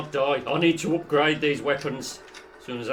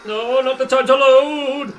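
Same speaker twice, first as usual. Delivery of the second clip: loud high frustrated shout; frustrated yell; 10 semitones higher, 11 dB louder than usual. Real, not acted. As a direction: yell at the reload. Reload at wrong moment.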